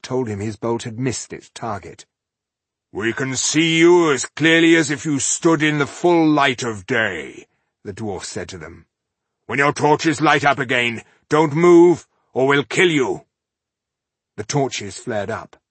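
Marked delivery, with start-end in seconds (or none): 2.9-7.5 menacing stranger. Acted. Dwarf threatening from darkness. 9.5-13.3 loud, high death threat; menacing threat. Acted. Dwarf threatening to kill.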